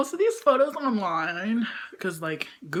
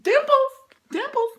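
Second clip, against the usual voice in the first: very high loud squeal on dimples; 9 dB louder than usual. Real, not acted. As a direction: shriek the word in delight.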